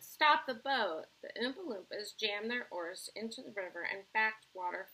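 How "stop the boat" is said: loud, drawn-out call, pitched up; shouted command. A narrator's reading. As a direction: call out an order to halt. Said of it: "Mister Wonka shouted".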